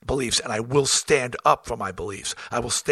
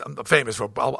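ordinary talk, nothing marked

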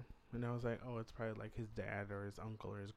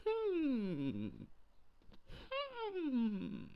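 put-on suspicious old man. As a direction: hum doubtfully like a nosy old neighbour.

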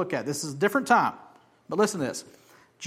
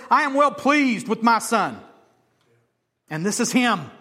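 higher, louder proclamation of God; preaching God's words; 7 semitones higher, 8 dB louder than usual. Acted. Preacher voicing God's approval to the crowd, loud. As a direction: announce it with fervour. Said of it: "And God says".

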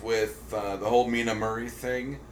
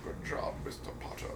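Alan Rickman; 5 semitones higher, 13 dB quieter than usual. Pretend. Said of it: "gonna be Alan Rickman leaving himself a voicemail".

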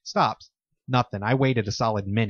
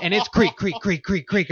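imitated creaking; 7 semitones higher than usual. Imitating creaks coming closer.

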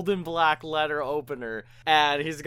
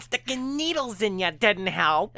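mock scolding; 8 semitones higher than usual. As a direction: throw it at him like a complaint. Wife berating husband in pain.

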